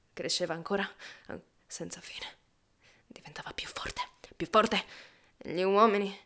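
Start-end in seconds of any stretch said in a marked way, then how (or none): 1.3-4.0 "più forte" breathed in a soft whisper; hushed. A narrator's reading. Narrator hushing before the noise swells. Noise growing, building contrast. 4.3-5.1 "più forte" punched loud with a rising end; burst out louder. A narrator's reading. Narrator bursting out as the noise swells. Noise growing, building contrast.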